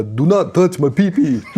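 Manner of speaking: high, loud hammy Count vampire voice. Acted. Host plays a vampire guarding his pee-pee, hammy Count voice. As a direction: ham it up like the Sesame Street Count. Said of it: "You sounded like the Count".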